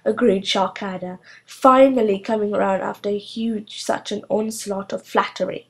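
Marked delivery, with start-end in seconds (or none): none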